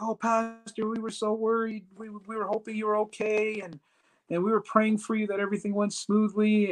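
high-pitched gushing concern; put-on high voice of concerned ladies; imitated worried ladies. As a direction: mimic fussing, relieved well-wishers in a high voice. Ladies' relieved greeting, voiced high.

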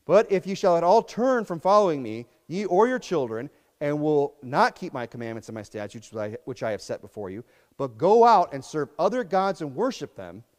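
loud, measured King James reading; preacher reading God's words aloud from Kings; scripture reading. Acted. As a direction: proclaim the verses with weight.

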